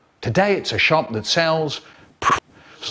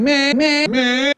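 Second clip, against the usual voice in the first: put-on high-pitched whining child; whiny kid; 11 semitones higher, slower than usual. Whiny pupil in a strict teacher's class, whining. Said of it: "you had the tiny kid going".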